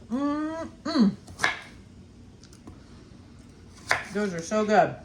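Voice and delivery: talking through a mouthful; mouth full. Real, not acted. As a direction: chew while you say it. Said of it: "I'm talking with my mouth full".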